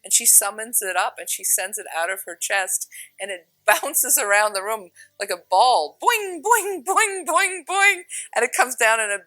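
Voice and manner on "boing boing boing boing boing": high, loud cartoon boing boing; bouncing noise. The love light bouncing round the room, high loud boings.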